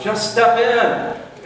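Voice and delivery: high, slow call from the fire truck. Retold or imitated. Rescuer on the fire truck calling to the stranded man, high slow shout. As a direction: coax him aboard with a drawn-out shout. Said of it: "and yells".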